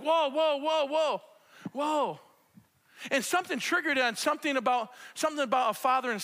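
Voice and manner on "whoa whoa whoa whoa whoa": awed whoa. Retold or imitated. Awe at God during show.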